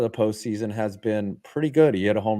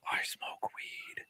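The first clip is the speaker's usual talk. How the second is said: breathy whisper, much softer, slow; whispered confession; 17 dB quieter, slower than usual. Retold or imitated. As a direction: confess it under your breath like a secret. Retold confession to a cop.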